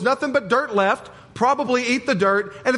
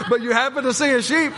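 higher, put-on British accent, playful; mock British accent; 4 semitones higher than usual. Pastor playfully quoting a UK farmer in a British accent. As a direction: slip back into the British video guy's accent. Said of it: "I don't know what accent that is I'm using".

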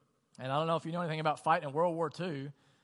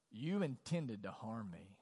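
slow, lower reading of the accusation; slow, heavy quote; 5 semitones lower, 4 dB quieter, slower than usual. Retold or imitated. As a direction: let each word land slowly and gravely. Reading Genesis quote twice.

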